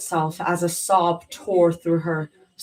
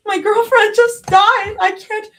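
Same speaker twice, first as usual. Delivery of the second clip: much higher, loud desperate cry; panicked grief; 10 dB louder than usual. A narrator's reading. Panic after girlfriend stabbed.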